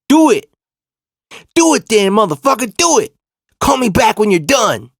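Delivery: high, loud imitated shout; defiant. Girlfriend calls cousin's bluff on the phone, defiant mock yelling.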